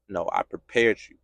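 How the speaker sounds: very low, soft fatherly voice of God; quiet reassurance. Acted. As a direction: reassure a hurting son in hushed tones. God calmly answers the protest.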